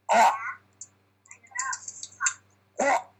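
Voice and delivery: choking on a chicken bone; strangled throat-clearing warm-up. Acted. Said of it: "sounds like you're choking on a chicken bone".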